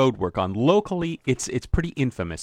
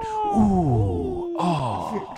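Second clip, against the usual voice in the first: disappointed groan; 5 semitones higher than usual. Retold or imitated. Groan at a missed putt.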